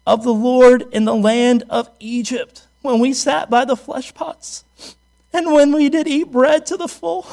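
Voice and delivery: loud, slow, declaiming scripture; scripture reading. Acted. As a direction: read it out like the King James.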